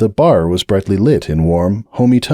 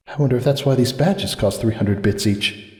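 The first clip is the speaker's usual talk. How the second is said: softer, wry aside to herself; dry inner thought; 2 semitones higher, 5 dB quieter than usual. A narrator's reading. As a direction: muse quietly and a little wry. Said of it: "Cupcake thought".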